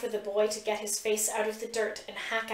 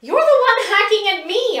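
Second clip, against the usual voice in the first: high, loud angry retort; furious snarl; 10 semitones higher, 10 dB louder than usual. A narrator's reading. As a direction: snap it back in outrage. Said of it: "I snarl back".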